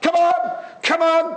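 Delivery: high, loud shout of come on; urging a boy onto the pitch; retold yelling. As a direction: coach shouting encouragement. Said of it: "and I was like".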